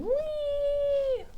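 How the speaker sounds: high squealing whee; a delighted high-pitched whee; playful whee. Real, not acted. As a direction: squeal it out with glee. Squeals while finger painting.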